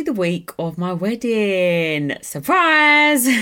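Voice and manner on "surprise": loud, high gleeful announcement; spring the news on the viewers; excited surprise reveal. Real, not acted. Vlogger to her viewers, bursting out with a loud excited reveal.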